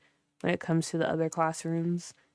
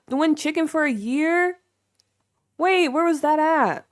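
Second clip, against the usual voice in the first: high, loud disbelief; astonished; 9 semitones higher, 7 dB louder than usual. Real, not acted. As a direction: pitch leaps up in real amazement. Astonished by viewer's free chicken news.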